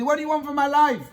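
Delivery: angry retort. Retold or imitated. Yaakov snapping back at his pleading wife, angry. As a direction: snap back like a fed-up husband. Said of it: "He gets angry".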